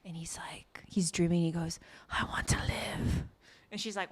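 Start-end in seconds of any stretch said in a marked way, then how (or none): 0.1-1.4 whispering. Real, not acted. Whispered narration aside. 2.1-3.2 hushed, slow sleep-talk whisper; dreamy whisper. Retold or imitated. Sleep-talking quote.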